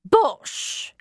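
high, dismissive outburst; blunt cook. A narrator's reading. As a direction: snort it out like a brusque cook. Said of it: "exploded the much more forthcoming cook".

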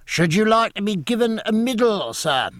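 high, carrying formal call; umpire calling out. A narrator's reading. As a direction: call the question down the pitch. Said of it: "called the umpire".

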